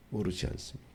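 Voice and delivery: quiet pleading echo of the question; hushed repeat. Acted. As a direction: drop to a near hush for the echo.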